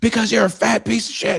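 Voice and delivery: shrill loud mocking yell, way up high; guard insult. Guard insults the kid about his weight, high and loud. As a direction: screech the insult at him.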